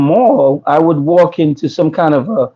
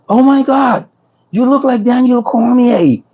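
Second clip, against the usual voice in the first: higher-pitched imitation of an amazed onlooker; mock astonishment, pitched up; astonished recognition; 6 semitones higher than usual. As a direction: retell his amazement in a raised voice. Attorney's astonished reaction, retold.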